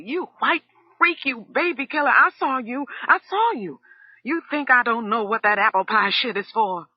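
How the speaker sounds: very high, loud wild screaming; shouting wildly. A narrator's reading. Cook held back by Son, wild screaming. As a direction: scream it out of control. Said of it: "Undine was shouting wildly".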